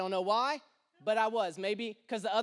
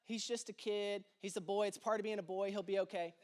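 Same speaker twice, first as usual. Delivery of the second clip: soft, low, shrugging calm; falsely casual dad, softer and lower; mock nonchalance; 4 semitones lower, 7 dB quieter than usual. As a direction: play it off like it's no big deal, softly. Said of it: "I'm like".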